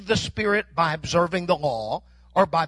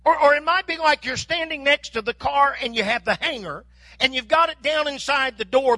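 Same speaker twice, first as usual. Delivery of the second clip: usual speaking voice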